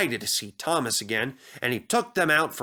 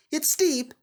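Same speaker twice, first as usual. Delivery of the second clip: Percy's voice; 13 semitones higher than usual. Acted. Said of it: "he thought".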